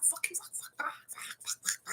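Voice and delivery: hushed, breathy string of curses; whispered cussing. Pretend. Showing off a potty mouth in a hushed whisper. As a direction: whisper the swears under your breath.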